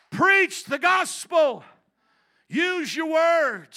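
slow, high, shouted exhortation; full-force preaching. Real, not acted. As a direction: hurl each word out with long vowels.

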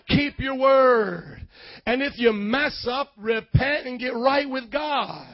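emphatic commands with stretched endings; preaching at full force. Real, not acted. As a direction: hammer out each rule to the congregation.

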